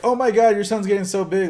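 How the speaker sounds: high gushing mock voice; gushing acquaintance. Mocking a nosy acquaintance, gushing high. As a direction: gush over the baby like a fake friend.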